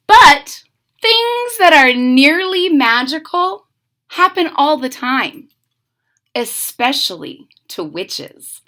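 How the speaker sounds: put-on lively read-aloud for kids; storybook narration. A narrator's reading. Reading a witch story to children, lively narration. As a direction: tell it like a bedtime picture book.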